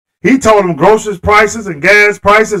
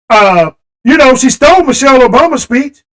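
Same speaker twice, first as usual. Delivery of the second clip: usual speaking voice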